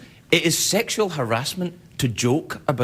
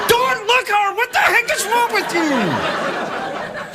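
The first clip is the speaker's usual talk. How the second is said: mock scolding; slower than usual. Host scolding himself for staring, high mock shout. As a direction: scold yourself in a high yelp.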